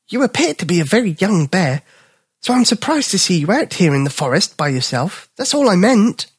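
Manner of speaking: high, apologetic dog voice; smooth it over kindly; gentle little dog. Acted. Little dog explaining himself to Bipper, high gentle voice.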